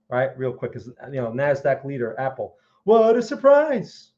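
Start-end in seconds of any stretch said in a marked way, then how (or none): 2.8-4.1 sarcastic surprise. Real, not acted. Sarcastic remark on Apple chart.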